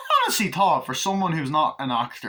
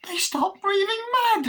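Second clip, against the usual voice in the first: very high, panicked squeal; Glenn panicking; 4 dB louder than usual. Retold or imitated. Glenn shocked by blood, a very high squeal. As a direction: squeal it in fear. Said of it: "Glenn is like".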